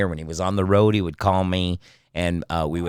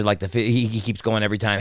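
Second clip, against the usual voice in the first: ordinary delivery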